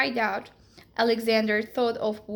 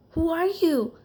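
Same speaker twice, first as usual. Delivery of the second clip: a little frightened; 7 semitones higher, 4 dB louder than usual. Acted. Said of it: "a little frightened".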